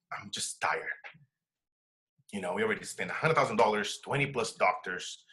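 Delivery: low, quiet, drained retelling of a worn-out wife; retold weary wife. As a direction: voice his wife's exhaustion, soft and deep. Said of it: "she's just like".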